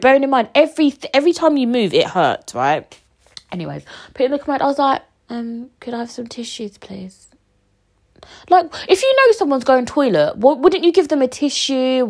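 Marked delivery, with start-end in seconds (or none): 5.3-7.3 soft, trailing off to a whisper; timid request. Retold or imitated. To the rude nurse, timidly asking for tissues, fading to a whisper. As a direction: ask meekly, afraid to bother her.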